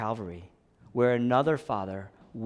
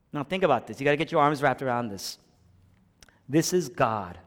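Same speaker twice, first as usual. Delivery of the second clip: normal talking voice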